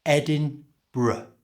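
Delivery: Edinburgh slowly, ending in a short bruh; Edinburgh with the end cut to bruh; careful Edinburgh, short bruh ending. Shown as a demonstration. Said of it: "so it's just bruh".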